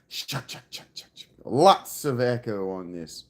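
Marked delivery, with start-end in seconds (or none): 0.1-1.3 whispered kick-drum chucks; whispered kick imitation. Shown as a demonstration. Streamer imitating the song's kick drum in a whisper. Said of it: "an extremely open kick".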